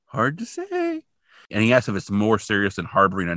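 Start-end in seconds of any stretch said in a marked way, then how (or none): 0.1-1.0 high, evasive line; Scully's dodge. Retold or imitated. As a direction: say it lightly up high, dodging. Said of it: "And she's like".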